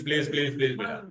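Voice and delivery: ordinary talk, nothing marked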